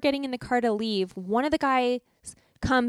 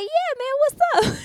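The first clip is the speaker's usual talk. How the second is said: very high, hammed-up greeting; mock slang greeting; 4 dB louder than usual. What the guard hoped tourists would answer, mock slang greeting. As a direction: imitate an over-the-top hearty hello. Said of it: "turn around and be like".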